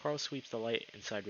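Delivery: ordinary talk, nothing marked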